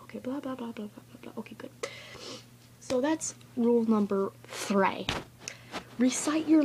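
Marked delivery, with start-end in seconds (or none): 0.0-1.7 very soft run-through of filler words; mock rehearsal mumble. Going over the script to oneself, hushed and offhand. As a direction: rehearse under your breath.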